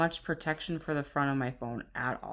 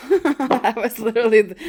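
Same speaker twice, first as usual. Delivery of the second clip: loud, higher burst of laughter through the words; laughing; 3 semitones higher, 10 dB louder than usual. Real, not acted. Vlogger amused by her own coffee mug mess, laughing. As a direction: laugh while explaining.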